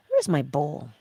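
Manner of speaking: usual speaking voice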